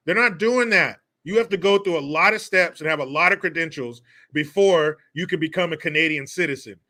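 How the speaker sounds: ordinary delivery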